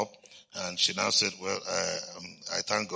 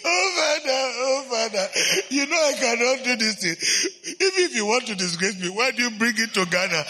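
mock crying plea; 12 semitones higher than usual. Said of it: "still had her crying".

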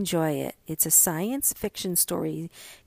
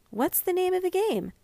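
high calling question; 11 semitones higher than usual. A narrator's reading. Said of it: "mother calls after Mink".

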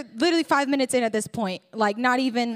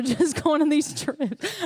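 laughing; 4 semitones higher, slower than usual. Real, not acted. Laughs at own wording.

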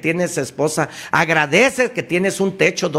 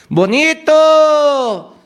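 bonito stretched slowly, much higher and louder; drawn-out shout; 13 semitones higher, 6 dB louder, much slower than usual. Shown as a demonstration. Shouting into the hills to test the echo, long slow yell. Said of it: "grítale".

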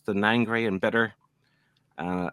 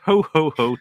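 louder, higher Santa ho ho ho; jolly ho ho ho; 7 semitones higher, 9 dB louder than usual. Retold or imitated. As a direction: read it out like Santa. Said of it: "Kev is here".

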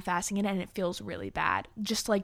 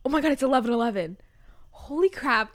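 fast, louder burst of excitement; excited; 4 semitones higher, 4 dB louder than usual. Real, not acted. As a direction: rush it out, thrilled. Noticing the clock reads 11:11.

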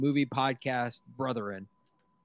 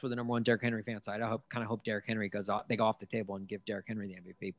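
rushed; 3 semitones lower, 6 dB quieter, faster than usual. Real, not acted. Rushed quiet aside.

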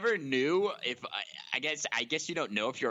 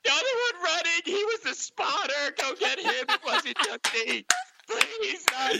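high, loud hammed-up sobbing; crying; 14 semitones higher, 5 dB louder than usual. Crying kid blaming the spotter to police, hysterical. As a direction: wail and plead like a panicked kid. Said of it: "I just start crying".

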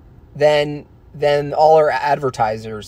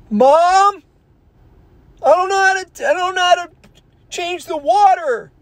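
put-on helpless child. Child begging mom about the water, very high-pitched. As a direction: whimper it like a lost little kid. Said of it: "and be like".

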